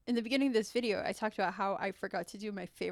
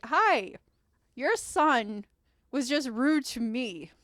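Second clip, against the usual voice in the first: loud, high hi, then slow complaint; affronted mother; 3 semitones higher, 7 dB louder, much slower than usual. Acted. Mother reports rude son to his mom, pointed indignation. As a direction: play a mom pointedly reporting bad behavior. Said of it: "the other mother's like".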